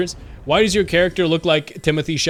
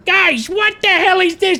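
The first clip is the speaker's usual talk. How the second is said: much higher, louder shocked cry; shocked Godfrey; 14 semitones higher, 5 dB louder than usual. Retold or imitated. Guilford Godfrey finding the camp ruined, loud and much higher. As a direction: yelp it out in disbelief. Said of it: "he was like".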